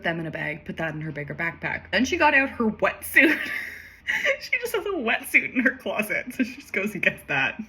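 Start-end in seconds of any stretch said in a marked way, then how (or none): 3.4-4.4 high breathless laugh; breathy laugh. Real, not acted. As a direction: let out an airy laugh. Speaker laughs at wetsuit.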